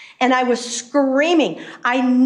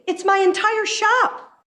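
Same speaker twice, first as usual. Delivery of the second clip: higher, incredulous exclamation with a weight on shop; exasperated; 9 semitones higher than usual. Retold or imitated. Telling the legislator the whole shop is the issue, higher, exasperated. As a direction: throw up your hands in disbelief. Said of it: "I said".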